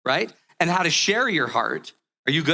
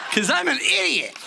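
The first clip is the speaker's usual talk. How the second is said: laughing self-mockery; 8 semitones higher than usual. Real, not acted.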